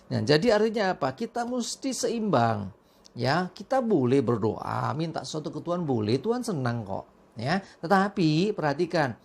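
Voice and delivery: ordinary talk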